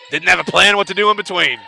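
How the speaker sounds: brisk live basketball call, rising on big plays. Acted. Commentator calling the game to fans, brisk play-by-play.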